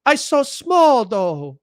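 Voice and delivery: very high, slow cutesy mock Japanese accent. Mimicking opponents playing small, mocking Japanese-accented voice. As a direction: whine helplessly in a caricatured Japanese accent. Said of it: "I don't know why it became Japanese".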